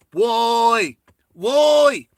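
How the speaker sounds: high, loud drawn-out yell; high-pitched, sustained mock holler; mock calling out. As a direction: imitate her hollering it high and long. Imitating her calling out his name.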